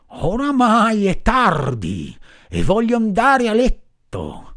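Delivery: lightly voiced stern farmer, slowish. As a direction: lay down the law. Farmer gives orders.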